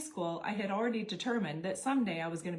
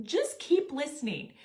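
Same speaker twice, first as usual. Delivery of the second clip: higher and louder, impatient husband; insist, losing patience; frustrated; 7 semitones higher, 5 dB louder than usual. Retold or imitated. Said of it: "He's getting frustrated with me".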